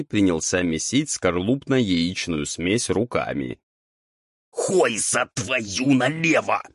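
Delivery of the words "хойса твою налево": high, raspy exclamation, drawn out; Roul crying out. A narrator's reading. Ghoul stops devil mixing by hand, crying out. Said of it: "вскрикнул Роуль".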